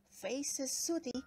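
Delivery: usual speaking voice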